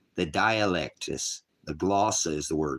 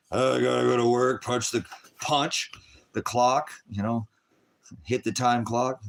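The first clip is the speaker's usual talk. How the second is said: mock worker voice; 7 semitones higher, 4 dB louder than usual. Mocking worker heading to job.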